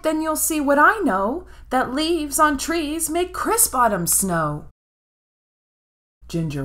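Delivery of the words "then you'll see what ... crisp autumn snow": put-on excited girl. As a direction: make mom look, bursting. Ginger insists.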